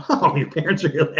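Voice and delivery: talking through real laughter; helplessly amused, words broken up by laughs; laughing. Real, not acted. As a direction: let the laugh spill into the words. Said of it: "I'm sorry, I'm laughing".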